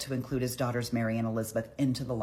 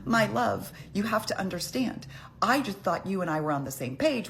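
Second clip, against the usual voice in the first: sweet-talking wife; 7 semitones higher than usual. Retold or imitated. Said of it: "she's like".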